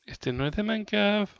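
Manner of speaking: usual speaking voice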